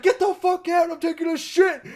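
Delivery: high mock yell from the stall; mock angry yell. Old guy in the stall yelling at intruder, mock yell. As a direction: play the annoyed old man yelling. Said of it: "some guy's like".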